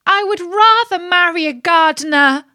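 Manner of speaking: high, loud desperate outburst; impassioned cry. A narrator's reading. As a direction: cry it out, on the edge of tears. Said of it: "suddenly cried out the little princess".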